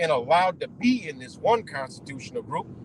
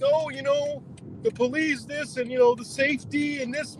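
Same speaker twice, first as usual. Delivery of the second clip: high mock whiny voice, higher; mocking the people; 7 semitones higher than usual. Ranting vlogger mocks people who praise the police, high whiny voice. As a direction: parody their police-and-safety excuses.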